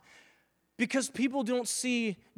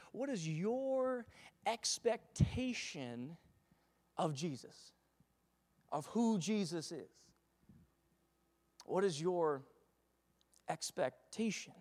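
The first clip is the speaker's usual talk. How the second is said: lento e suave; slow, soft, drawn-out question; 3 semitones lower, 8 dB quieter, slower than usual. Shown as a demonstration. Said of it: "slow repeated question".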